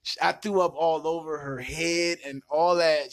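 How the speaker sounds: ordinary talk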